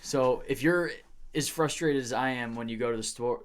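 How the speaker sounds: ordinary talk, nothing marked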